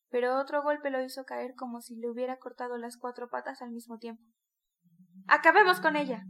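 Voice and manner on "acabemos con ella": much louder, higher roar; grinding roar. A narrator's reading. Micolca urging his men to finish the mare, fierce roar. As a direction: roar it through clenched rage. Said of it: "crujió Micolca".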